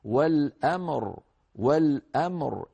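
والأمر slow, clear ميم with no echo, heavy still راء; recitation demo. Shown as a demonstration. Tajweed teacher shows students the stop on والأمر. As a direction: say والأمر slowly, stopping on the راء. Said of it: "لاحظوا".

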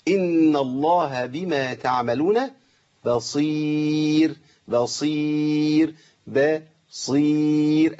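slow recitation, light ba then heavy saad in بصير; correct saad. Shown as a demonstration. Teacher modelling for students, بصير slowly stretched, light then heavy. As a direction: draw out the ee of بصير long and level. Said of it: "بخلصها كده بالهيئة".

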